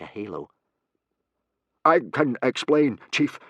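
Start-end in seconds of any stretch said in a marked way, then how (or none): 1.8-3.4 stammering. A narrator's reading. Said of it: "stammered Gobber".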